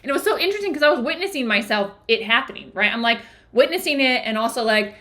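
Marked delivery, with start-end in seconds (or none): none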